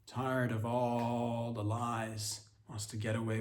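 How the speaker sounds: ordinary talk, nothing marked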